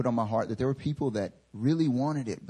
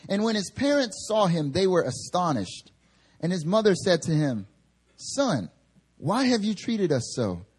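higher, measured reading voice; scripture reading; 6 semitones higher than usual. Acted. Preacher reading Luke aloud to the church, measured reading. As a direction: read the passage to the congregation.

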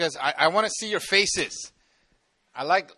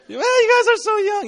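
amazed; 14 semitones higher than usual. Real, not acted. Marvelling at young crowd.

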